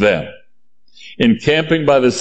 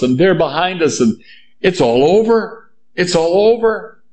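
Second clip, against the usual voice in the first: higher-pitched panicked complaint; acting out an alarmed, grumbling crowd; complaining Israelites; 5 semitones higher than usual. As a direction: protest as if all is lost. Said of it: "They're complaining".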